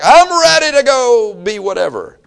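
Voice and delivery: high, loud, drawn-out mock enthusiasm; overzealous eagerness. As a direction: imitate someone bursting with zeal. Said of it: "a great deal of zeal".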